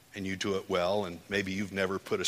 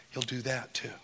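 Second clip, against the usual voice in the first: usual speaking voice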